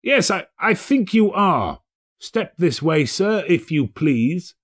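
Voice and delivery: high mock-stern echo; feigned stern Scrooge. A narrator's reading. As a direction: pretend to be outraged.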